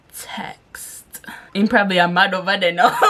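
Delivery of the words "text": whisper. Pretend. Fades into whisper.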